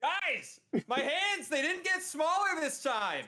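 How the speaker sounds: high, loud shout to the group; roleplayed shouting. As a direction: shout as if your volume control is broken. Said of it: "you are shouting and cannot control your volume".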